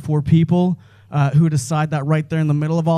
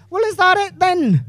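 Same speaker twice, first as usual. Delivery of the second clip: very high squeaky Scottish-ish question; mock Scottish bystander; 13 semitones higher than usual. Edinburgh crowd asking Batman, squeaky mock Scottish. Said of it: "they're like".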